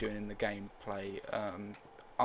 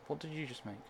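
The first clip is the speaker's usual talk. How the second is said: normal talking voice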